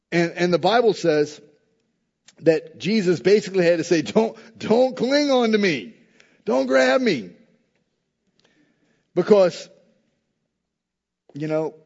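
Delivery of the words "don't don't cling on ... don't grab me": high, drawn-out playful objection; mock protest. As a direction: fend off an overeager hug. Jesus restrains clinging Mary.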